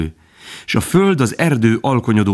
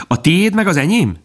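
put-on high, eager child's question; high childlike fawn voice; little fawn voice; 11 semitones higher than usual. A narrator's reading. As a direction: ask like a small curious fawn. Little fawn asks his mother.

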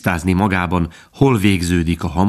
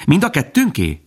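put-on high, eager child's question; high childlike fawn voice; little fawn voice; 8 semitones higher than usual. A narrator's reading. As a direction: ask like a small curious fawn. Little fawn asks his mother.

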